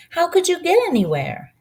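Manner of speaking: lightly voiced boy. Narrator voices boy Alex.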